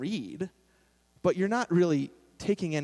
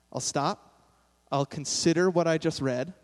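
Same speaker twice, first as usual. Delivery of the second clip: usual speaking voice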